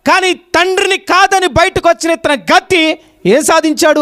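high, loud, ringing pulpit delivery; full-force preaching. Real, not acted. Preacher recounting the prodigal son's fall, full-force preaching. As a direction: drive each phrase out to the whole congregation.

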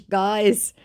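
ordinary talk, nothing marked

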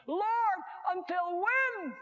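loud, high cry drawn out slowly. Acted. Martyrs under the altar appeal to God, crying out. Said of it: "they're crying out, they're saying".

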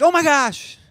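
high, loud mock astonishment; over-the-top fake surprise; shocked. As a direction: ham up total shock. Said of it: "But he's like".

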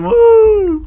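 high drawn-out whoop; celebratory, high-pitched cheer. Real, not acted. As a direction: let out a long high "woo". Cheering on his clan.

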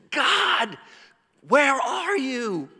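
very high, slow, anguished call; cry of dereliction. Retold or imitated. Preacher voicing Jesus crying out on the cross, anguished. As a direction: stretch it out like a forsaken plea. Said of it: "that cry of just".

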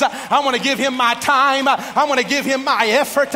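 loud, rising sermon cadence building to a shout; full-force preaching. Acted. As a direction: preach it up to the rafters.

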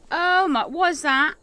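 high, loud startled gasp of a question. Real, not acted. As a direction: jump at the sight. Startled by creature.

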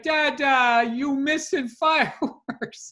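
put-on high, slow child voice with a laugh; toddler daughter. Toddler telling her dad about fireworks, high childlike. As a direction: sound like a little kid. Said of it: "She's like".

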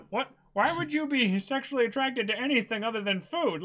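high, loud, lightly voiced baffled Luffy; a hint of a cartoon hero who only cares about food. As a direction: play Luffy puzzled and loud. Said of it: "Luffy's like".

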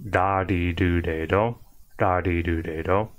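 da, di, do, de, do run together with soft tapped D; low, even da, di, do drill, tongue lightly flicking; soft-D Japanese R. Shown as a demonstration. Teacher repeating the kana row for learners, soft-D R. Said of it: "it sounds like a soft D".

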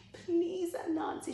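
high-pitched pleading voice; starving woman begging. Acted. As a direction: beg for help in a high voice. Starving woman begs for food.

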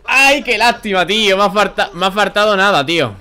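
loud groan at a near miss; frustrated outburst. Real, not acted. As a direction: shout it, so close. (grito de rabia por poco)

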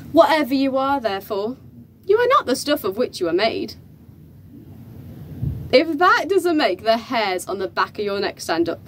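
ordinary delivery

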